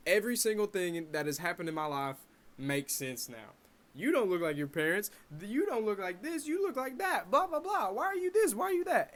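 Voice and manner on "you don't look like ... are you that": high, sing-song mockery; higher, mock sing-song taunting; mocking teasers. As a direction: parrot the teasing kids. Mocking childhood comments about looks.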